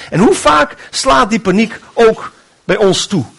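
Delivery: normal talking voice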